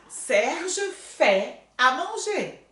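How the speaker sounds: Serge fait à manger, slow and deliberate; teacher modelling slowly; slow model sentence. Shown as a demonstration. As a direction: spell it out word by word. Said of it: "Produção".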